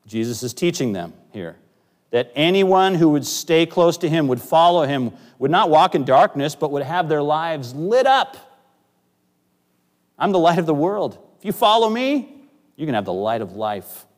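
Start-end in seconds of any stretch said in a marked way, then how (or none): 2.1-14.1 rising, louder preaching; preaching at full force. Acted. Pastor urging the congregation to follow, raised preaching. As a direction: lift the promise up to the hall.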